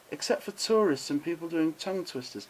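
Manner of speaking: ordinary talk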